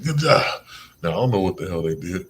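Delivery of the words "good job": much higher, louder good job; high excited praise. Retold or imitated. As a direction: throw out a bright good job. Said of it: "I'll be like".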